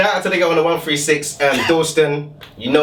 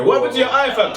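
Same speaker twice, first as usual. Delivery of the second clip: much higher mock friend voice; friend's question; 11 semitones higher than usual. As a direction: voice the curious mate. Friend asking back home.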